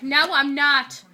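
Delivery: loud, drawn-out retold refusal; mock defiant refusal. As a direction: imitate refusing, slow and loud. Retold quarrel, Frankie refuses.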